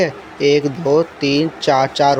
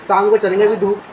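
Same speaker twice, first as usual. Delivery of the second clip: ordinary talk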